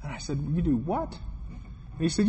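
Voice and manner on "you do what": quiet incredulous question, pitch jumping up on "what"; mildly stunned, replayed in retelling; retold disbelief. Preacher replays his reaction to the laborer's method, incredulous. Taken aback by shocking answer.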